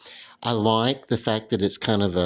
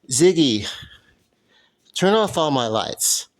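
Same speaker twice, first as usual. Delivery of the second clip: calling out; 6 semitones higher, 4 dB louder, slower than usual. Real, not acted. Calling voice assistant across room.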